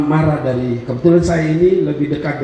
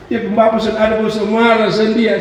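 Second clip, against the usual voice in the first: higher-pitched imitation of mother's teasing; 4 semitones higher than usual. Mother needles her crying child, higher mock-teasing voice. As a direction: taunt the child in a raised voice. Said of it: "mama saya selalu bilang begini".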